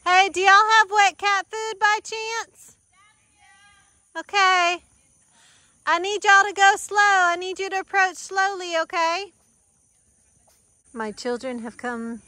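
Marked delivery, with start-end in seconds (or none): 0.1-9.3 calling out loud. Real, not acted. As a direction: shout over to the family. Calling to family far off.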